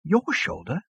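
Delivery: a touch of appalled disbelief; horrified whisper. A narrator's reading. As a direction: ask it aghast. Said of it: "in a horrified whisper".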